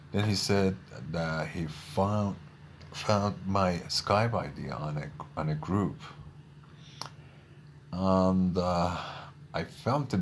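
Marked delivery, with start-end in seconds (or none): none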